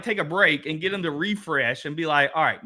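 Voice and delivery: normal talking voice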